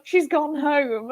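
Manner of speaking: slow, high, tearful telling; mock-crying out the news. Girl on holiday telling mum Nicole left, tearful. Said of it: "And I just start crying".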